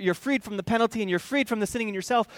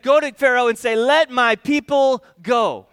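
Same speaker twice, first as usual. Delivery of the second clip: booming God voice; 3 semitones higher, 8 dB louder than usual. Retold or imitated. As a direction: boom it out like the voice of God. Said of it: "God didn't say big cheese".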